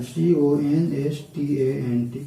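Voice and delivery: slow spelling of सी ओ एन एस टी ए एन टी; spelled out letter by letter. Shown as a demonstration. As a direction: say each letter of सी ओ एन एस टी ए एन टी slowly and separately. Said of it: "constant".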